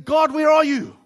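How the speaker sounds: full-voiced anguished shout to heaven; desperate cry. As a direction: yell it up at God in despair. Crying out to God while driving.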